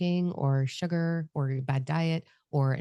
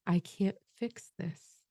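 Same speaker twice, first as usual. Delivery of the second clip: slow, soft, higher apology; sorrowful apology; 4 semitones higher, 3 dB quieter, slower than usual. Retold or imitated. Apologizing to her cigarette, slow and sorrowful. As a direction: ache through each word. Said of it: "And I said".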